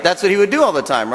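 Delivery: usual speaking voice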